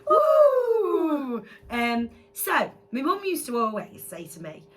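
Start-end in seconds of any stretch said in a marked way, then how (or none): 0.1-1.4 cheer. Real, not acted. Cheering segment intro.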